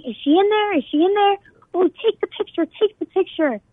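high, urgent mock yell; retold yelling. Kids at the dorm door hunting for her, high mock yell. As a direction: call it out like kids banging on a door. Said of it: "they're yelling".

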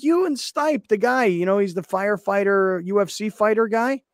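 high, insistent mimicry; imitating Lawhead. Host mimicking colleague explaining who the fighter is, high-voiced. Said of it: "He's like".

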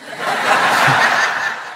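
breathy unvoiced laugh at the joke. Real, not acted. Laughs at own story.

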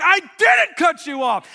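high, slow, full-volume shout of denial; yelling back. Retold or imitated. Driver denies cutting the other man off, loud indignant yell. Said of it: "And we're yelling".

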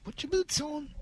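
high, soft mock mom voice calling the kids; mock Giselle voice. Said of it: "Does she talk like that now".

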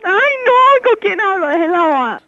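fed-up outburst. Real, not acted. Woman fed up with prank caller.

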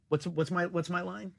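hushed, halting aside; forgetting the line. Acted. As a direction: softly ask for your forgotten line. Acts out forgetting his line.

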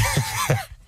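squeaky laugh way up high. Real, not acted. Cracking up at the knife in the bag, high squeak.

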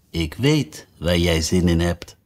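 low, slow kind queen voice; queen offering Alice a biscuit; kindly. Acted. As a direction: offer it gently, knowingly. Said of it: "zei de koningin goedhartig".

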